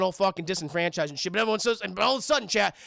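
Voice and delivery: normal talking voice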